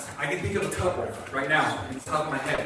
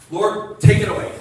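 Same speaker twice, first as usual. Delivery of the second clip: loud, urgent mock prayer; pleading prayer; 3 semitones higher, 10 dB louder than usual. Pastor acts out our prayer in danger, loudly pleading. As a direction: punch it out as a demand to God.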